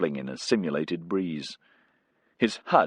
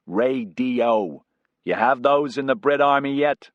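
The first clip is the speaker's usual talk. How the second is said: lightly voiced brash American pilot, raised; cocky pilot calling back to the passenger, a bit higher; Casey the pilot; 5 semitones higher, 4 dB louder than usual. Pilot Casey joking to Bisesa over headset, breezy.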